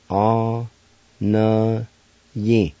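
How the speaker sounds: "A Na Yin" as three isolated syllables with pauses; syllable-by-syllable pronunciation. Shown as a demonstration. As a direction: sound out "A Na Yin" one syllable at a time. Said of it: "It's pronounced like this".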